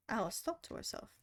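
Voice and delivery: usual speaking voice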